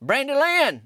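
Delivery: sharp high holler of her name. Retold or imitated. Neighbor hollers at upset woman in the parking lot, high and loud. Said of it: "And I hollered at her".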